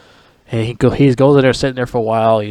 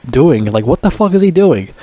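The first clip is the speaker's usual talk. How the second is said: higher, incredulous yelling at the screen; what is he even doing, pitched up; baffled outburst; 5 semitones higher than usual. Retold or imitated. Vlogger yelling at actor fighting badly, higher and baffled. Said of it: "I'm like".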